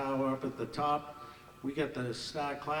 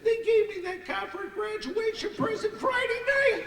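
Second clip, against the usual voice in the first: put-on high, louder girlish lament with a laugh; girlish wail; 6 dB louder than usual. Girl confessing the car was a graduation gift, high girlish wail. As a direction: squeal it like a panicked young girl. Girl fears her parents' anger.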